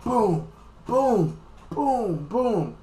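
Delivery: high, falling booms, evenly spaced; cartoon impact noises; imitated punch booms. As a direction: voice each punch landing with a high boom. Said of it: "like a punching bag".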